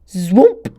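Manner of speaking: loud whooshing zwoomp; loud swooping fireball noise. A narrator's reading. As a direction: throw the fireball with your voice. Fireball whooshing sound effect.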